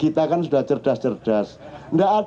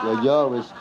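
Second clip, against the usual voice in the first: usual speaking voice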